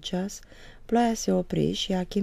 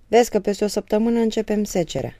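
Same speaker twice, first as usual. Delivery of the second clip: loud call over his shoulder; father calling to the son in the road, loud; said loudly; 6 semitones higher, 7 dB louder, faster than usual. Acted. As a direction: raise the voice so he hears from the road. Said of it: "zise Moromete tare".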